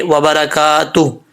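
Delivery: slow व बरकातु closing on breathed h; silent final ha. Shown as a demonstration. Teacher shows the stop, careful tajweed. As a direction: draw out का in व बरकातु, no vowel after h. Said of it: "हा को भी साकिन कर देंगे".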